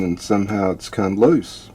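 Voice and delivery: usual speaking voice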